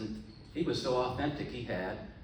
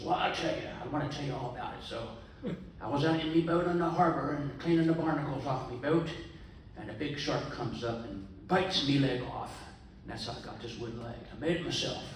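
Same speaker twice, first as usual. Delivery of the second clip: pirate voice; 3 semitones higher than usual. Acted. Old pirate telling the young man at the bar, in a hammed-up pirate voice. Pirate tells shark story.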